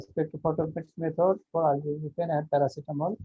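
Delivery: ordinary talk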